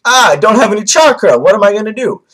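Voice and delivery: high, loud mock panic; hammed-up frantic cry; Naruto. Vlogger acting out Naruto out of chakra, mock panic. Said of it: "Naruto's like".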